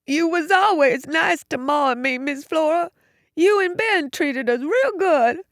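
put-on high-pitched, childlike tearful voice; upset, high, countrified lament. A narrator's reading. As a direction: plead through tears like an overgrown child. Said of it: "Tears ran down his face".

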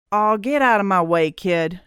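usual speaking voice

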